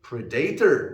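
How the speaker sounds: "predator" as pre-DAY-ter, middle syllable stressed; wrong stress. Shown as a demonstration. Teacher showing a mistake, "predator" stressed on DAY. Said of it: "the stress is wrong".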